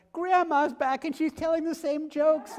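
very high, loud gleeful cry; mock delighted announcement. Audience greeting old jokes like grandma's return, gleeful high cry. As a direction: proclaim it on one high pitch. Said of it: "as if it was like".

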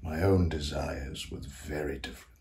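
soft, low confession; hushed confiding. Acted. Composer confesses his desires to ghosts, hushed confiding. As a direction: let the ambition out quietly, with pauses.

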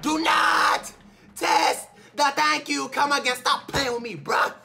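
loud, high, slowed taunting yell; hype taunt. Real, not acted. Gamer taunting the game after a catch, loud slow mock threat. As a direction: warn the game not to test him.

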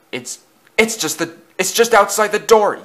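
high, stammered yelp; stammer, then yelp it out; frightened yelp. A narrator's reading. Thomas hears it at the door, stammering high yelp. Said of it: "yelped Thomas".

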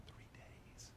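whispered in awe. Real, not acted. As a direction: breathe it out in wonder. Repeating the amazing fact.

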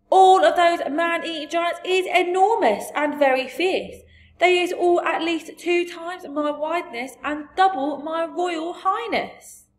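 loud, high, agitated giant; BFG. A narrator's reading. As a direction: proclaim it in alarm, slowing on the last words. BFG cries out about giants.

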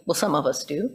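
ordinary delivery

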